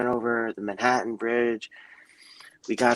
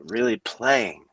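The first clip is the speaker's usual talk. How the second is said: ordinary talk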